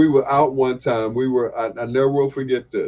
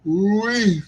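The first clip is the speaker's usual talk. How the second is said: yawning; 8 semitones higher than usual. Real, not acted. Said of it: "Excuse me for yawning".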